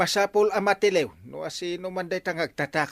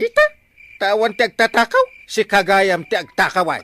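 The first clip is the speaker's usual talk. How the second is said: loud accusing scold; angry scolding; 4 semitones higher, 10 dB louder than usual. Acted. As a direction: hammer home the accusation. Uncle scolding thief nephew.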